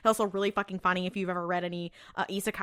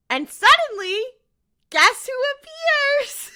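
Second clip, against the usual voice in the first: high, loud build-up with laughter in it; giddy, drawn-out announcement; excited reveal; 8 dB louder, slower than usual. Real, not acted. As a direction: squeal the big reveal, stretching it out. Hyped-up reveal of a favourite character.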